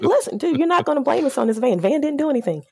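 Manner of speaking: firm but joking; scolding with humor. Retold or imitated. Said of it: "It's like".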